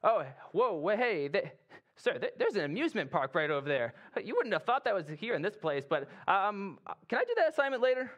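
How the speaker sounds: hammed-up awestruck soldier with a high pleading finish; distracted soldier. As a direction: get giddily sidetracked, then plead sheepishly with the officer. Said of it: "Or maybe he was like".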